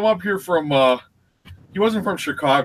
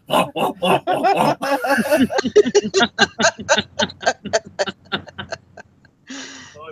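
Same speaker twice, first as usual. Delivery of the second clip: throaty gobbling grunts, breaking into laughter; Cookie Monster munching; 7 semitones higher, much slower than usual. Pretend. Imitating him when he eats the cookies, gobbling growls. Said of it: "when he eats the cookies".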